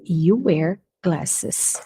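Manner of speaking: very slow dictation; extra slow model. Shown as a demonstration. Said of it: "teacher repeats".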